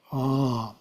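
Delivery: Ah. held long and soft, open vowel; prolonged ah. Shown as a demonstration. Teacher demonstrating the first syllable of Om, long soft ah. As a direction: stretch out Ah. softly. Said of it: "but prolonged".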